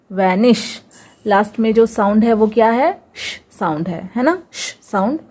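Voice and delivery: ordinary talk, nothing marked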